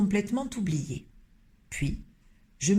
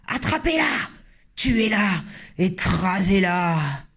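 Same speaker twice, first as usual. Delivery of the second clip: frantic shouts; slower than usual. Acted. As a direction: bark kill orders at the mouse. Cooks chasing the mouse.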